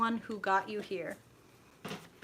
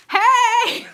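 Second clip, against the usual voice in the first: high, loud burst of a greeting; excited call-out; 13 semitones higher, 15 dB louder than usual. Real, not acted. Host calls out hello to her live viewers, loud and high. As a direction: shout hello to the chat with a big lift.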